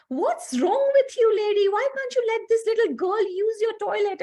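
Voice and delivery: high, loud, upset yell at the lady; retold screaming at the attendant. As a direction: shout at her in outrage. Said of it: "howling and screaming for no reason".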